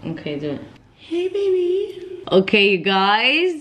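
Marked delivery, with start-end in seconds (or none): 1.0-2.2 put-on high, slow girly voice; man doing a woman's voice; girl voice. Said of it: "Let me do my girl voice".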